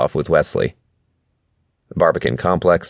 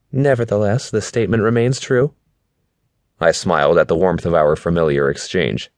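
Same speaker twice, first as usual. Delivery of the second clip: Mom's voice; 4 semitones higher than usual. Acted. Narrator voices Mom.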